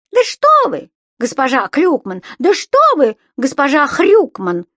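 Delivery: high, loud mocking echo of a protest; господин Пепперминт impression. A narrator's reading. Imp jumping in to insult landlady as tenant, mocking mimicry. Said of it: "подхватил Субастик голосом господина Пепперминта".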